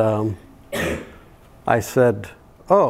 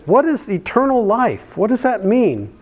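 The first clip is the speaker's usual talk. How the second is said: little daughter asking; 9 semitones higher than usual. Retold or imitated. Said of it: "She said".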